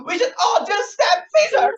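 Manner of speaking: high, loud half-crying shout; shouted outburst. Retold or imitated. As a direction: yell it, voice cracking up high. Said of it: "she's like".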